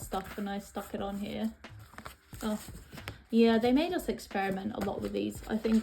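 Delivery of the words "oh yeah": ordinary talk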